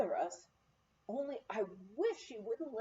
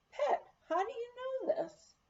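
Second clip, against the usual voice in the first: higher, caught off guard; startled question; 12 semitones higher than usual. Retold or imitated. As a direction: ask it in sudden surprise. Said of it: "I stopped dead".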